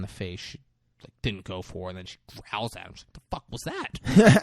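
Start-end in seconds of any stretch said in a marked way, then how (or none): 3.1-4.4 high, put-on creature voice; goofy high raptor voice. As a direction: voice the raptor, affronted.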